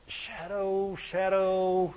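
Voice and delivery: hushed, drawn-out musing; mock pondering. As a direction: murmur the word slowly as if mulling it over. Mimics aimless pondering of a word.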